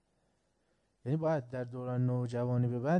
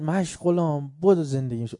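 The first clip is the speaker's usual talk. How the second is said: loud, higher call for help across the field; retold shout; 4 semitones higher, 5 dB louder than usual. Farmer yells to neighbour Mashghulam as the fire spreads, loud shout. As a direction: yell the neighbour's name in alarm. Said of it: "داد زد".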